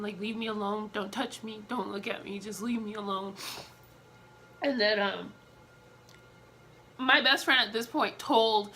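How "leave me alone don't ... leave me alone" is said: soft, pleading, wanting to be left alone; quiet plea. Retold or imitated. To her husband, softly begging him to leave her alone. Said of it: "I'm just telling him".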